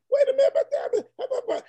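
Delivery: high, laughing mock protest. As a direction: squeak it out through a laugh. Retelling being confronted by nurse.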